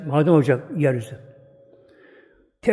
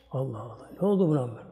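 ordinary delivery

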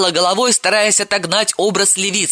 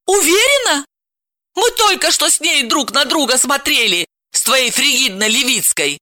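exasperated wife; 9 semitones higher than usual. A narrator's reading. Wife's exasperated retort.

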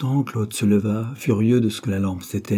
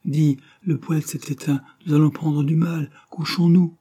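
higher, insistent imploring voice; pleading; 6 semitones higher than usual. A narrator's reading. Cold, waiting woman begs her partner to bed, pleading. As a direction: plead with him, pitch climbing to the end. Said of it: "Cette voix suppliante le pénétra".

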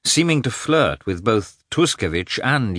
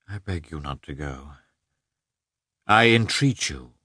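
tender entreaty; 3 semitones lower than usual. A narrator's reading. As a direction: beg her gently not to go. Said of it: "with tender entreaty in his voice".

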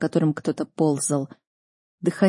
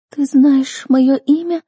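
put-on high, slow, tearful girl's question; pitiful girl asking, voice raised in pitch; plaintive sob; 7 semitones higher, 6 dB louder, slower than usual. A narrator's reading. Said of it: "жалостливый всхлип". (жалобно, высоко, сквозь слёзы)

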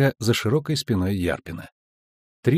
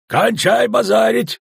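loud, high, gruff rebuke; slow, forceful, grumpy command; gruff order; 11 semitones higher, 5 dB louder, slower than usual. A narrator's reading. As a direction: cut off the chatter roughly, drawn out. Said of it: "буркнул Ярпин".

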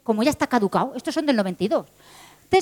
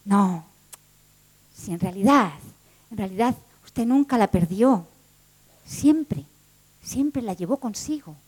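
slower, low and soft, fading; gentle reveal; 5 semitones lower, 4 dB quieter, slower than usual. Acted. Clerk gently telling the woman she never lost it, low and soft. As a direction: tell her tenderly.

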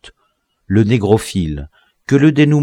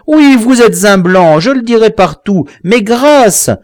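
loud, much higher, frantic pleading; desperate begging; 12 semitones higher, 8 dB louder than usual. A narrator's reading. The victim begs his killer for his life, high and desperate. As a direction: babble anything to be spared.

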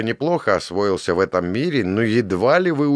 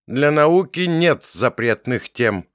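proudly, slowly, with raised chin; proud declaration; 6 semitones higher, 3 dB louder, slower than usual. A narrator's reading. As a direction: state it with pride, a touch higher. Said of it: "гордо заявила Сардара".

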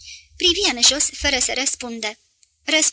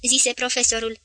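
plain reading voice

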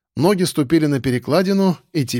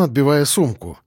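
ordinary delivery

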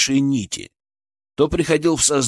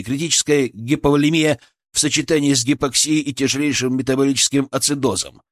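ordinary delivery, nothing marked

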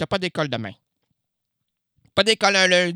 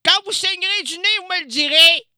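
much higher and louder, piercing; testing a piercing high register; shrill high voice; 13 semitones higher, 5 dB louder than usual. Shown as a demonstration. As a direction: push the voice way up into a screech. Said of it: "C'est trop strident".

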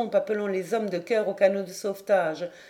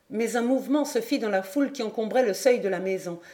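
plain reading voice

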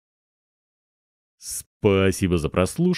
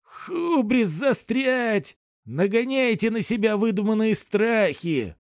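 baron voice; 12 semitones higher than usual. Acted. Baron mocks fears, excited.

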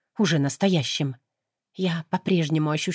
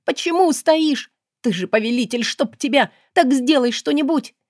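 furious pleading; 7 semitones higher, 3 dB louder than usual. Acted. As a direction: demand he act, beating his chest. Heroine beats demon's chest.

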